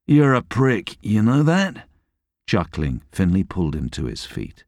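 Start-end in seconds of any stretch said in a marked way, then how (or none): 0.1-1.9 mock insult. Insulting friend after sparring.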